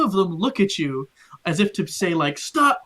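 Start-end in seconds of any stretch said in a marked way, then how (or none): 2.4-2.8 sharp high shout of stop; guard shouting. Acted. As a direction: yell at him to halt. Guard shouts at stumbling figure.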